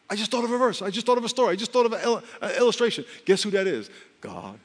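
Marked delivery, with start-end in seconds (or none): 0.1-3.0 higher, bright with sudden recognition; like an idea just struck you; sudden realisation. Retold or imitated. A believer suddenly remembers a verse, excited.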